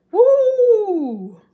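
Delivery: loud, high-pitched "woo"; high whoop. Acted. Reacting to how the locals talk, high whoop. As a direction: let out a big piercing whoop.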